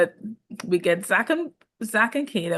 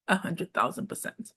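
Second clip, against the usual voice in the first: normal talking voice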